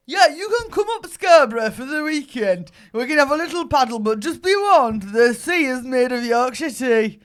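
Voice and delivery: loud, hammed-up Yorkshire accent, slowing into the warning; big comic attempt at Yorkshire. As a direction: proclaim it in a put-on Yorkshire voice. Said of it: "can I try it again?".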